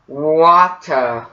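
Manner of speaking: British-accent demo of Water, no R. Shown as a demonstration. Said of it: "let's try it in a British accent".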